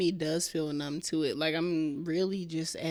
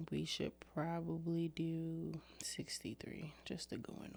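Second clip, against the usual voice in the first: whisper; 12 dB quieter than usual. Real, not acted. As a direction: whisper it so the mic barely catches it. Quiet aside about clips.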